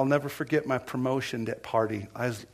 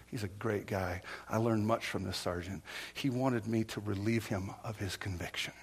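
usual speaking voice

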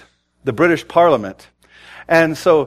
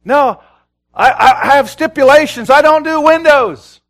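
higher, louder, slowing on the last words; mock stubborn excuse; 11 semitones higher, 6 dB louder than usual. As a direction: whine out a picky excuse like a fussy servant. Mocks believers' stipulations to God.

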